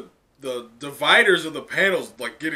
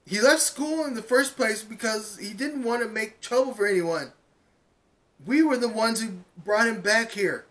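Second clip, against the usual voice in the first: put-on much higher earnest girl's voice; Uraraka pleading; 7 semitones higher than usual. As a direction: plead with them from the heart. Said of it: "And Uraraka goes".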